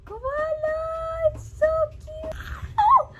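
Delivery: very high, drawn-out squeal; delighted squeal. Real, not acted. Vlogger unboxing the koala, delighted high squeal. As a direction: squeal over something adorable.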